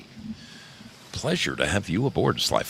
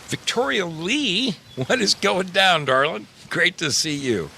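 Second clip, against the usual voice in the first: high sing-song greeting; 11 semitones higher than usual. Acted. To a chat viewer, a high sing-song greeting.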